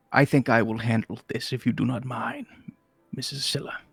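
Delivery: put-on old wizard. GM voices old wizard Malcador.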